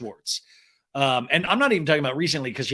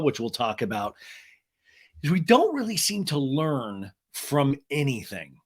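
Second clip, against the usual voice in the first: ordinary talk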